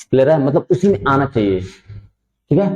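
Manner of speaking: ordinary delivery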